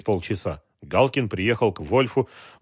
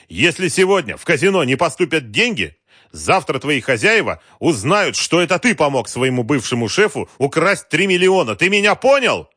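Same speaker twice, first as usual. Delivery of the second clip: loud, raised, menacing warning; threatening; 8 semitones higher, 7 dB louder than usual. A narrator's reading. Wolf threatening the general by phone, forceful. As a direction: lay down the threat loudly, building to a shouted question.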